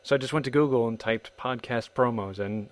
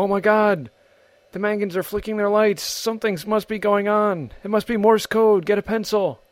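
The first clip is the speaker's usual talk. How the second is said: alarmed neighbor; 10 semitones higher, 5 dB louder than usual. Retold or imitated. Neighbors panicking over flickering lights.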